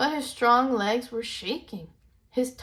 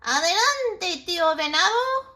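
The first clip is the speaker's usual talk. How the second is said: high, louder call; calling ahead; 11 semitones higher, 6 dB louder than usual. Acted. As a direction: shout from ahead. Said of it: "heard a voice call".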